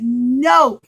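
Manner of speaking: loud flat no; firm refusal. Acted. Son refusing the king, loud and firm. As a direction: refuse him outright.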